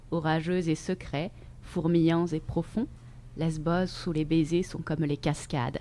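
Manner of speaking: soft, measured poem recitation; poetry reading. Acted. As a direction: read the verses softly, letting each phrase settle. Reads a poem aloud. (lecture de poème, posée et douce)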